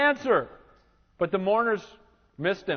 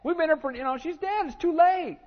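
mocking scorn; 10 semitones higher than usual. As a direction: sneer that it's over, pitched way up. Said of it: "they ridiculed and said".

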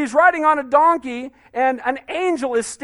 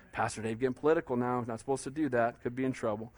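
much softer, quick muttered self-teasing; tongue-in-cheek aside, much quieter; sheepish aside; 11 semitones lower, 13 dB quieter, faster than usual. Real, not acted. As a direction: drop the voice like a confession to the front row. Self-mocking aside about politics.

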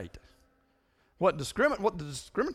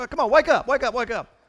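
louder and higher, rousing the crowd; rousing shout; 7 semitones higher, 11 dB louder than usual. Real, not acted. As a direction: call out loud to wake the room. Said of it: "you guys are so quiet".

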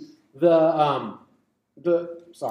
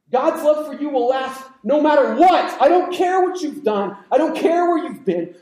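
raised, loud, driving sermon climax; impassioned preaching; 6 semitones higher, 5 dB louder than usual. Real, not acted. Preacher assuring the congregation of God's lasting love, impassioned. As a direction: preach it at full force, pitch riding high.

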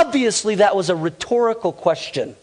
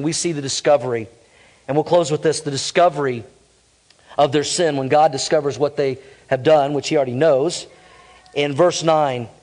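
usual speaking voice

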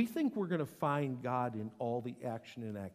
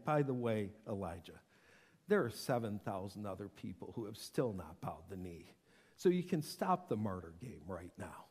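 offhand aside; 8 semitones lower, 5 dB quieter than usual. Acted. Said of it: "God can't resist saying".

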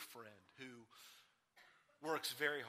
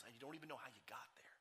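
hushed whisper; 8 dB quieter than usual. Real, not acted. Sermon point dropping to hush.

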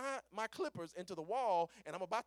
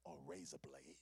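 low, hushed horror reveal; very soft, low storyteller's reveal; ominous whisper; 11 dB quieter than usual. Retold or imitated. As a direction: whisper it with dread. Dread reveal of the blade.

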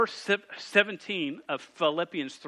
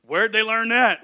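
loud, higher, mock-shocked parent; mock surprise; 6 semitones higher, 11 dB louder than usual. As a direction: sound shocked at the kids, hammed-up. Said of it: "And you're like".

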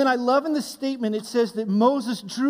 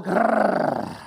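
dog growl. Retold or imitated. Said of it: "we hear God go".

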